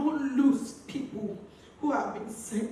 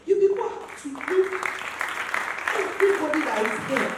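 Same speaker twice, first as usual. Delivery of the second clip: raised, higher voice calling out to the crowd; loud appeal to mourners; 6 semitones higher, 7 dB louder than usual. Real, not acted. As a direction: project it to everyone at the memorial. Addressing mourners at tribute.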